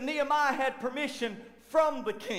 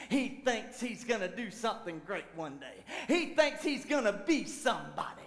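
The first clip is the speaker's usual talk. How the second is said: mocking sneer; 2 semitones lower than usual. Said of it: "they're just making a mockery of him".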